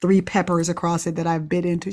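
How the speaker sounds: usual speaking voice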